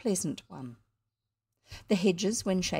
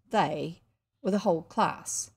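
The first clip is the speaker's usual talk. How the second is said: ordinary delivery, nothing marked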